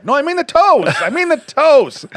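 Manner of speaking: high, loud insistence; hammed-up exasperated clarification; mock exasperation. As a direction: protest loudly, stretching the repeat. Acted exasperated clarifying to wife.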